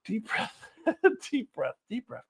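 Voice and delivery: soft, giggly self-soothing; calming oneself down. Real, not acted. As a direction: quietly talk yourself down through leftover laughter. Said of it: "I'm losing control again".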